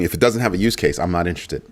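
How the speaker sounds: usual speaking voice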